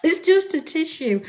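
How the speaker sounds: high, loud, laughing through the words; laughing protest. Retold or imitated. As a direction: protest innocence while giggling. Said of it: "I'm like".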